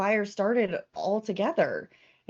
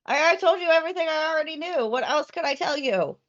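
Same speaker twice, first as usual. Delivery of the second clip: higher goat character voice; 6 semitones higher, 4 dB louder, faster than usual. Acted. Goat protests interrogation.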